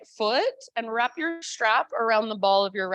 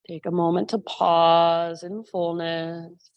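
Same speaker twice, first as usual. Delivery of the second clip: guided meditation; 4 semitones lower than usual. Acted. Guides a breathing relaxation.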